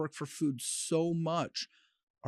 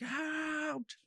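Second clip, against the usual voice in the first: handler yelling; 14 semitones higher, 7 dB quieter than usual. Retold or imitated. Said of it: "I don't like yelling around dogs".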